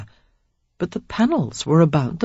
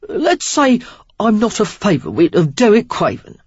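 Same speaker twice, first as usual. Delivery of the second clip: sly Cockney voice, a bit higher; Cockney stranger; 5 semitones higher, 4 dB louder than usual. A narrator's reading. Stranger explaining why he hides, sly Cockney. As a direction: admit it with a rogue's wink in Cockney. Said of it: "a healthy dose of Cockney in his accent".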